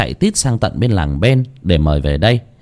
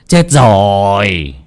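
slow grim repeat; 3 dB louder, much slower than usual. Acted. The master sees the open eyes, slow ominous chết rồi. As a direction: stretch out chết rồi like a bad omen. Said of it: "mà mắng ông Chí".

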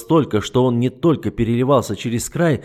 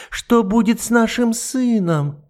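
high, very slow tearful cry; a mother's sobbing wail; sobbing plea; 12 semitones higher, 4 dB louder, slower than usual. Acted. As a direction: plead through sobs. Matilda begs.

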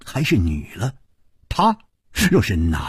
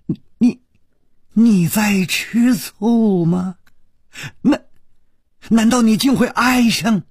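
higher, stammering, shocked questions; stunned dying woman; 8 semitones higher than usual. Acted. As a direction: gasp out the questions in disbelief.

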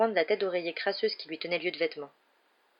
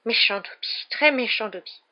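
lightly muttered elf self-scolding; mild self-reproaching elf mutter; 4 semitones higher, 3 dB louder than usual. A narrator's reading. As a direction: scold yourself as a dazed elf. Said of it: "en louchant et en marmonnant".

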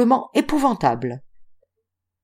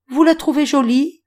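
higher, teasing, pleased with herself; coy pride; 8 semitones higher, 3 dB louder than usual. A narrator's reading. Girl asking Octave about her dress, coyly pleased. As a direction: fish for a compliment. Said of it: "avec une certaine complaisance".

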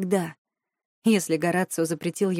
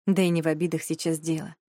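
usual speaking voice

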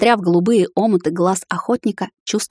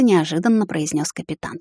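usual speaking voice

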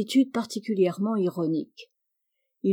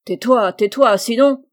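loud, threatening outburst; furious command; 3 semitones higher, 10 dB louder than usual. A narrator's reading. Captain silences his protesting orderly, furious. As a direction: shout him down with a threat.

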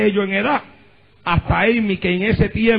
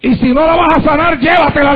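emphatic pleading prayer; 7 semitones higher than usual. Retold or imitated. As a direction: hammer the plea home to the congregation. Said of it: "le dijo".

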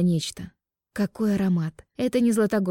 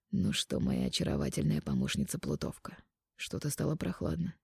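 soft, low man's voice; quiet intimate male lover; 3 semitones lower, 10 dB quieter than usual. Acted. Mark flirts.

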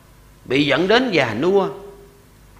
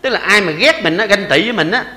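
normal talking voice

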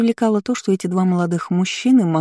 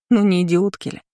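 usual speaking voice

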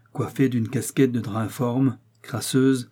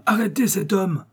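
the repeat pitched higher and more urgent; urgent repeated shout; 8 semitones higher than usual. A narrator's reading. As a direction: push the second call harder as he slips away. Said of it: "Il cria fortement aux agents".

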